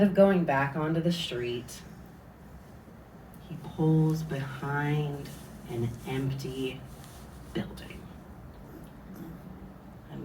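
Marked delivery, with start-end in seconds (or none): none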